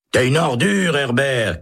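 high, indignant outburst; angry shout. Acted. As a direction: spit the insult across the cells. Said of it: "crie Holt". (insulte criée très haut)

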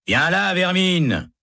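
high, slow contemptuous order; guard's harsh shout. Acted. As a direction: bark the order at the prisoner. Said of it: "crie un gardien". (ordre crié, lent et méprisant)

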